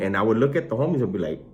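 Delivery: ordinary talk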